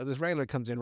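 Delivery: ordinary talk, nothing marked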